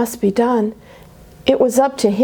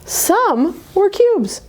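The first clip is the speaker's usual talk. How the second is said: playful high reveal; 10 semitones higher, slower than usual. Acted.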